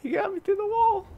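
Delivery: high, loud complaining yell; outraged yell. Real, not acted. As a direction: cry out in disbelief. Repeats complaint.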